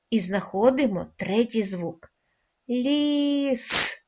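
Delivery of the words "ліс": Ліс stretched out, ending in a long hissing с; drawn-out final с. Shown as a demonstration. Teacher finding the third sound, drawn-out hissing с in Ліс. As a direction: say Ліс slowly on a raised pitch, holding the с. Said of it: "Знову звук сипить".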